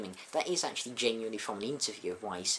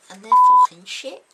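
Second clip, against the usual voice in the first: loud, higher ranting fans in a Scouse voice; mock fan yell; 21 dB louder than usual. [name] quoting angry fans, shouting. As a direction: bellow it like furious fans.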